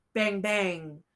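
usual speaking voice

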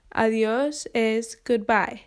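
usual speaking voice

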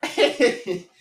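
laughing hard. Real, not acted. Cracking up at friend's rant.

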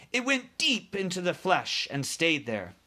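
ordinary delivery

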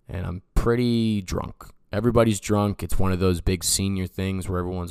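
ordinary talk, nothing marked

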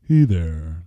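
low, mopey greeting; a glum, sinking hello; gloomy. Pretend. Co-host retrying the intro, still sounding gloomy. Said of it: "Still doing it".

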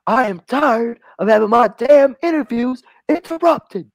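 high, fed-up host voice; annoyed squeal at being cut off; exasperated mock host; Stephen A. Smith. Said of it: "And Steven says".

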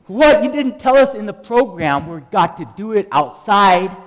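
loud, high outburst imitating an alarmed trainee; shocked protest. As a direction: blurt it out as her startled objection. Retelling Karen's shocked protest.

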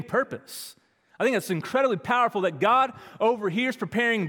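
loud, high, driving sermon voice; preaching at full force. Acted. Pastor urging the congregation, in full preaching voice. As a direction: preach it out with rising fire.

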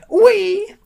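loud, very high screechy milk seller; piercing high character voice; Frau Milk. A narrator's reading. Frau Milk answers in her voice.

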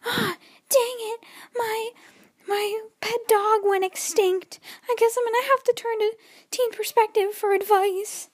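little sister teasing with a put-on whiny voice; mocking little sister. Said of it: "she just goes".

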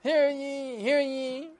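high, loud proclamation calling to the crowd; town crier. Acted. Herald announcing the king's reward to townsfolk, loud and high. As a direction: cry it out like a royal herald, slow and loud.

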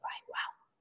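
breathless, shocked whisper; hushed gasp of shock; stunned whisper. Retold or imitated. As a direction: barely voice it, stunned. Hearing baby on respirator.

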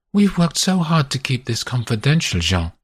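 lightly voiced woman, insistent; a woman in bed pleading for secrecy; Constance, earnest.

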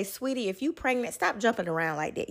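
soft and gentle, settling her down; soothing. Retold or imitated. Girlfriend calming the pregnant wife, soft and soothing.

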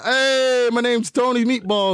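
high, loud, slow mock Italian-American tough guy; Italian-American impression. Halloween costume intro in a mock Italian-American accent. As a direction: ham up a New York Italian accent. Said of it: "Just said".

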